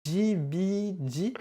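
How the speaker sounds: high, drawn-out letter names; slow questioning spelling. Real, not acted. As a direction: stretch each letter into a question. Épelle lentement le pseudo. (lettres étirées, très aiguës, interrogatives)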